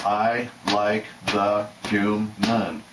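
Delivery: very slow, each syllable of "human" split apart, hu-man. Shown as a demonstration. Spelling out what he hears for viewers, slow and drawn out. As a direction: draw out "I like the human" one syllable at a time. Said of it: "I even broke down the word human".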